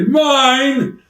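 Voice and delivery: high, loud, stretched-out holler; George's possessive yell. Acted. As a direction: bellow it like a wrestler claiming a toy. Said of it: "What does George say?".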